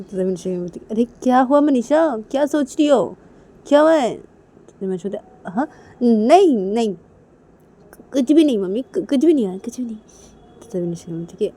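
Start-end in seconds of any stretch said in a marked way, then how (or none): 0.9-4.1 loud, higher-pitched demand to know; insistent questioning. Acted. Asking Manisha what is wrong, loud and insistent. 5.5-10.0 insistent denial. Acted. Manisha denying to mummy that anything happened, insistently. Acted hurried denial to mother.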